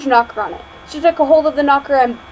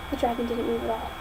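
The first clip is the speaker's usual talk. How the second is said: ordinary delivery, nothing marked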